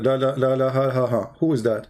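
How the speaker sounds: normal talking voice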